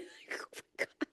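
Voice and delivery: breathy whisper through a laugh. Real, not acted. Mother to listeners, whispering exasperated after laughing. Laughing about cold baths.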